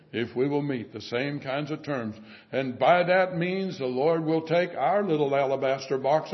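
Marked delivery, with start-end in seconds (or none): none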